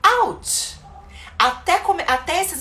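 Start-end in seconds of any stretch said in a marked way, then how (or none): 0.0-0.8 a much higher, louder out; playful ouch. Shown as a demonstration. Teacher demonstrating to parents, playful yelp. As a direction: squeak out a quick English ouch. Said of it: "você fala out".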